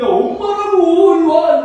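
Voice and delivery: very high, loud, drawn-out yell; insistent shout. Acted. Mother tells kidnapped child who she is, insistent shout. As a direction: shout it desperately, as an acted mother.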